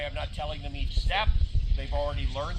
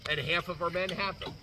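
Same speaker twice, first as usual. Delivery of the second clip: ordinary talk, nothing marked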